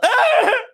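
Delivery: sudden loud, high fake sob; mock crying. Friend bursting into tears mid-chat about the Knicks, mock bawling. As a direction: break into exaggerated bawling. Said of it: "I don't know why I'm crying".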